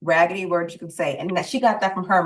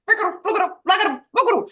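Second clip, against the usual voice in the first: high silly nonsense; 11 semitones higher, much faster than usual. Retold or imitated. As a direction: sing out nonsense words way up high. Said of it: "I was like".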